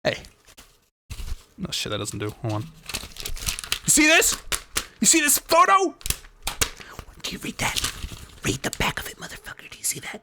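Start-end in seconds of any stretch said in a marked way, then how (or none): 2.6-5.9 high character voice. Acted. Player voicing character, high. 7.2-10.2 whispering. Acted. Hissed taunt.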